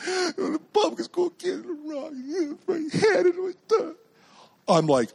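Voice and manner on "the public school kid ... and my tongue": high, blubbering mock wail; imitated crying child. Daughter telling Dad about the rock, crying, imitated. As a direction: sob the words out like a hurt kid. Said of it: "comes running home screaming".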